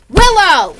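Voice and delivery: high, loud yell of the dog's name; shout at dog. Real, not acted. Yelling at her dog Willow to stop.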